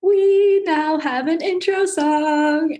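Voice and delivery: high, drawn-out excited announcement. Real, not acted. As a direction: stretch the words out with giddy excitement. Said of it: "I'm so freaking excited about it".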